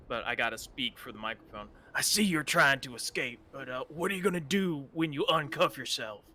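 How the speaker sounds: ordinary delivery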